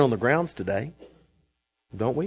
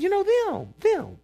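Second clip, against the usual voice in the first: much higher, teasing voice; teasing high pitched them; mock knowing reply; slower than usual. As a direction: squeak it out like it's obvious. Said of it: "I said".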